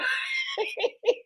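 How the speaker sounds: squeaky high laugh; a soft high cackle. Real, not acted. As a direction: let a high giggle out.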